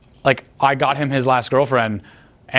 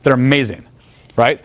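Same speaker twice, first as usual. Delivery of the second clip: ordinary talk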